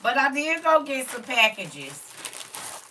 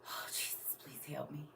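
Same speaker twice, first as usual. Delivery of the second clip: whispered, much softer plea to Jesus; a whispered prayer to hold back tears; 6 semitones lower, 17 dB quieter than usual. Real, not acted. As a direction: breathe a desperate little prayer. Praying while near tears.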